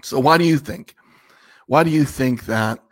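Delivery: ordinary delivery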